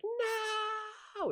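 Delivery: high, far-off squeaky mock scream. Retelling the Austin Powers steamroller scene, mock scream. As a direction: squeal it like a tiny figure far away. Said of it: "the guy's screaming".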